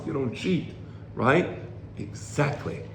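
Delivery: usual speaking voice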